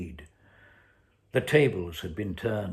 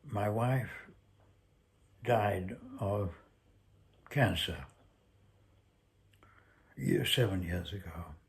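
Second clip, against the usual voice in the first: trembling voice. A narrator's reading. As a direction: hold back the tremor as you get it out. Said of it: "his voice trembled".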